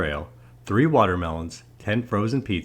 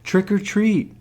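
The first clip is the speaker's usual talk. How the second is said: put-on high, raised child's call; a little louder; 12 semitones higher, 4 dB louder, slower than usual. A narrator's reading. Lance gets no answer from the fallen man, a little louder. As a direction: call it out higher and more firmly. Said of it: "He said it a little louder".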